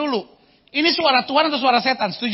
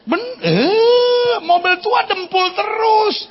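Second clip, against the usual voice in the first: comic shout; 8 semitones higher, slower than usual. Acted. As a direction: rail at the old car for laughs. Comic rant about old car. (teriakan lucu tentang mobil tua)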